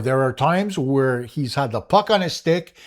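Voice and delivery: ordinary talk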